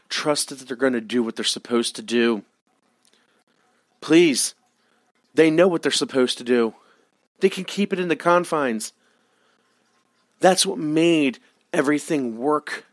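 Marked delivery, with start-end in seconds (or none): 4.0-12.9 ranting. Real, not acted. Ranting about WWE promos.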